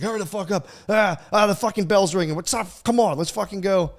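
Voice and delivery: high, loud mock hollering; hammed-up exasperated shouting on the school run; frantic rushing parent. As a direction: act out a harried parent yelling to hurry. Acted rush to school, bell ringing.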